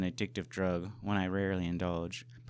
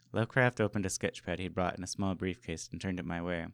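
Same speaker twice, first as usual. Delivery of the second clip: ordinary delivery, nothing marked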